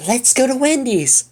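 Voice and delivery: high excited call; eager cry. Acted. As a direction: cry out, thrilled to go. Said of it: "Mabel cried".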